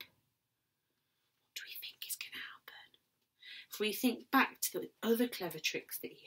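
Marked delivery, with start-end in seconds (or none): 0.0-3.8 whisper. Real, not acted. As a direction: draw the class in with a whisper. Teacher whispers question to class.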